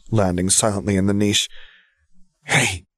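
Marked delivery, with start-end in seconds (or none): none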